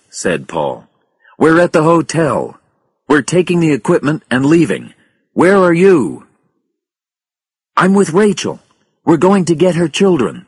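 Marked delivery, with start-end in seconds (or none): 1.4-6.3 loud, high-pitched and pressing; raised voice in an emergency; urgent radio call. A narrator's reading. Radio call during an evacuation. 7.8-10.5 raised, high-pitched reply; urgent radio reply. A narrator's reading. Radio reply during an emergency.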